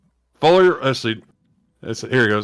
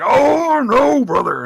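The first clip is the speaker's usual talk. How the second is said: high, loud pained wrestler cry; Macho Man screaming; 11 semitones higher, 5 dB louder, much slower than usual. Retold or imitated. Wrestler bitten by a cobra crying out in pain. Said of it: "Macho Man's there screaming".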